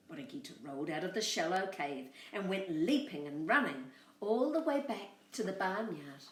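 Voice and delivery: plain reading voice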